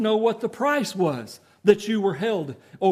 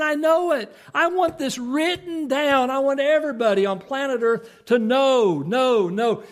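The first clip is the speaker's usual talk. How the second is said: higher, fired-up preaching; fervent preaching; 6 semitones higher than usual. Acted. Preacher voicing Job's certainty to the congregation, fervent. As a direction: proclaim it with rising fire.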